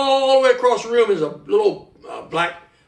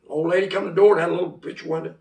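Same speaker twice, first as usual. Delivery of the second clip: plain reading voice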